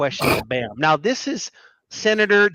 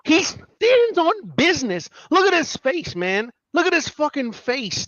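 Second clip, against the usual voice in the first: high, slow, amazed hype; excited hype; 10 semitones higher than usual. Real, not acted. Host marvelling at the senator's face, high and excited. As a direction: marvel at him, voice way up.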